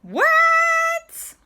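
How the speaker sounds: high, loud astonished "What?"; shocked gasp. Acted. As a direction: squeal it out in total amazement. Everyone shocked inside the tardis.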